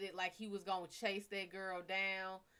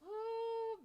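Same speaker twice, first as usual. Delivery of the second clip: high, drawn-out ooh; swooning ooh; 5 dB louder than usual. Real, not acted. Swooning over deep voice.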